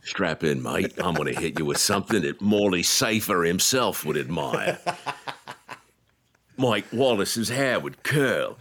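lightly voiced Aussie host, slowing at the end; a hint of an Australian DJ winding up; mock Australian DJ. Teasing the DJ's build-up, a light Australian accent. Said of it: "voicing the Aussie DJ".

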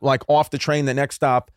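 usual speaking voice

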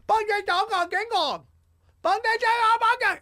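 high, loud fake Chinese syllables; mock Chinese gibberish. Imitating loud Shanghai talk for his co-host, mock Chinese. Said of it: "stereotypical Chinese, fake Chinese".

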